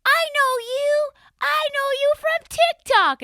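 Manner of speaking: imitated kid, very high, loud and drawn out; eight-year-old girl. Young daughter recognizes the host, high loud imitated child voice. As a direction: squeal it slowly like an excited little girl. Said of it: "the daughter, the eight-year-old one".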